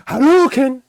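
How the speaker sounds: high, loud Hadouken shout from the gut; fighting game battle cry. Shown as a demonstration. Fan imitating a Street Fighter fireball attack, shouted. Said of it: "that's coming from here".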